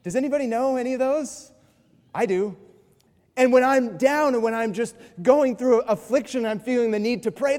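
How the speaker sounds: usual speaking voice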